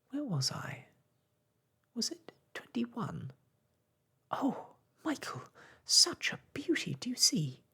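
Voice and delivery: put-on high, eager girlish whisper; excited. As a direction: bubble over with delight. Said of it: "bounced excitedly".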